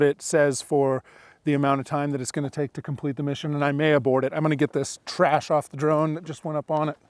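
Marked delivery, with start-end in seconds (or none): none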